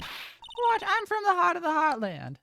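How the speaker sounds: high, indignant Southern squawk; Lotta Hart. Acted. Photographer defending her English to madame, indignant Southern accent. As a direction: protest loudly in a Southern twang. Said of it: "heard that southern accent somewhere before".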